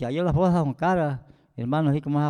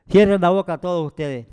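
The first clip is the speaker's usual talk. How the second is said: raised, higher voice on a retold command; louder, emphatic quoted order; retold stern order; 4 semitones higher, 8 dB louder than usual. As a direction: tell them all firmly to shut up. Retelling an order to keep quiet.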